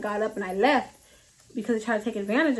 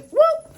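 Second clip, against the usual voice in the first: whoop sound; 5 dB louder than usual. Retold or imitated. Bursting into the house.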